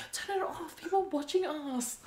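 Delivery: high-pitched retold protest; urgent mock plea. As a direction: imitate a flustered order to the cousins. Acts out urging cousins to stop.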